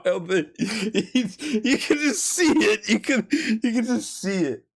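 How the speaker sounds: can't hold back the giggles; laughing through it. Real, not acted. Remembering the sergeant's goofy smile, laughing while talking.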